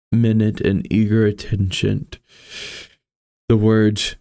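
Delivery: measured, somber storytelling read; story narration. A narrator's reading. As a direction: read it like a gothic tale. Reads Poe story aloud.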